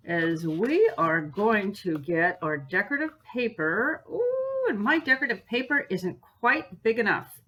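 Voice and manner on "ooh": very high ooh of sudden realization; surprised ooh. Real, not acted. Crafter discovering her decorative paper is too small, surprised ooh. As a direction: catch the problem mid-sentence.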